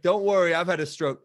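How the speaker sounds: lightly voiced owner joking to the crowd; cheery club owner shtick. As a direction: play it as a stage joke. Owner doing shtick on stage.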